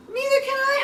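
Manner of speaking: very high, loud wail; howled alarm. A narrator's reading. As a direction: howl it in a piercing high voice. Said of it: "howled Flitter".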